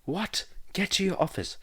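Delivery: high, urgent command. Acted. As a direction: snap out an order in alarm. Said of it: "Egon commands him".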